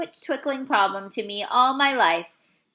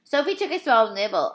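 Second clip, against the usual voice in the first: ordinary delivery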